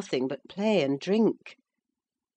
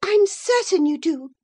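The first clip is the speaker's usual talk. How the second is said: high, loud comeback; heated retort; 12 semitones higher, 7 dB louder than usual. A narrator's reading. Isabella answers Catherine, heated high retort. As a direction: throw it straight back at her. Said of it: "retorted Isabella".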